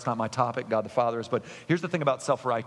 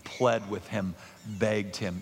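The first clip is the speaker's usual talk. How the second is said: usual speaking voice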